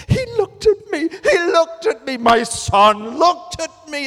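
high, loud joyful shout. Retold or imitated. New father shouting to the room, overjoyed. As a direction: shout it out in pure delight. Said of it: "I start shouting".

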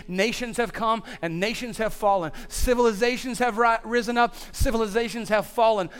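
rally the congregation with rising fire; preaching at full force. Acted. Pastor rousing Life Point Church on Memorial Day weekend, full-voiced preaching.

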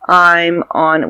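normal talking voice

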